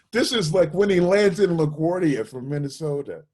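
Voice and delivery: high, loud, marvelling; amazed storytelling. Real, not acted. Musician telling students about a friend's first night, loud and amazed. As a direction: stress how fast it all happened.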